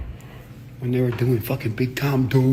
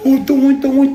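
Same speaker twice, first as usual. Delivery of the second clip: much higher, louder mocking squeal; mocking imitation; 13 semitones higher, 9 dB louder, slower than usual. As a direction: parrot him in a silly high voice. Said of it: "He's all".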